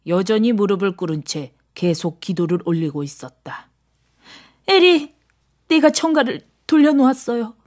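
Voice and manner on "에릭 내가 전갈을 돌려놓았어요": high, pleading female character voice; urgent, desperate. A narrator's reading. Christine tells Erik she turned the scorpion, desperate. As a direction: call out desperately, much higher.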